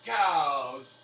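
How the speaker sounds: a tipsy guy chiming in; drunken shout. Acted. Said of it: "another guy goes".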